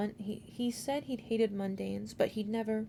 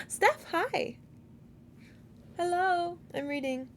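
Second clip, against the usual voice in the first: excited greeting; 9 semitones higher, slower than usual. Real, not acted. Reader greets someone excitedly.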